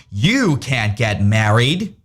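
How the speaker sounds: slow, menacing put-on voice; scary voice. A narrator's reading. Stink bug forbidding the bunnies' wedding, slow and menacing. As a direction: draw it out like a villain. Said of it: "said a scary voice".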